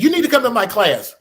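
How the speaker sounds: a high, loud imitation of a thick Russian accent; mimicking the Russian instructor's insistent invite in a Russian accent. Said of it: "she has that thick Russian accent".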